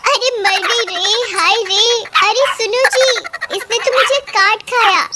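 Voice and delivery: high, wailing cry for help; hammed-up distressed wail; pained alarm. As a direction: cry out to a husband after a bite. Bitten woman cries out to husband.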